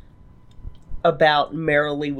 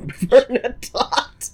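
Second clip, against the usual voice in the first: laughing; 8 semitones higher, much slower than usual. Real, not acted. Mocking the renamed character.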